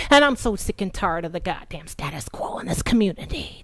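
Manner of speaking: usual speaking voice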